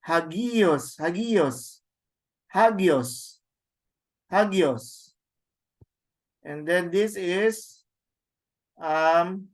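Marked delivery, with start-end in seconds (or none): 0.1-5.1 hagios with a clear breathed h at the start; rough breathing h. Shown as a demonstration. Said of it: "So it should be ha".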